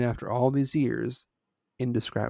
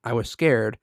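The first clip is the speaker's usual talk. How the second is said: plain reading voice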